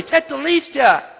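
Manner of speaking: high, ringing shout of triumph; loud, high-pitched victory cry; loud proclamation. Acted. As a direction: cry it out for everyone to hear. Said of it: "he says it loudly".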